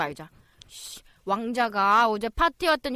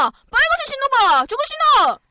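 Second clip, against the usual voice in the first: high, loud excited push; excited urging; 14 semitones higher, 9 dB louder than usual. Acted. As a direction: urge her on eagerly. Sister urging mother excitedly.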